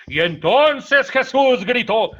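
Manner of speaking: loud, high, slow dramatic reading; reader's emphasis. Shown as a demonstration. Priest shows how announcers read a gospel line dramatically. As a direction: proclaim it like a radio announcer giving the text life. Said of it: "le ponen énfasis".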